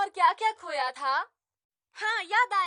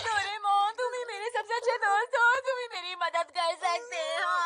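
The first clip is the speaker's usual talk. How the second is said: tearful wailing plea, speeding up midway; crying like a child; 3 semitones higher than usual. Acted. Nobita begging his best friend for help, childlike crying. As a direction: blubber childishly, gushing through the tears. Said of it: "बच्चों की तरह रोने क्यों लग जाते हो".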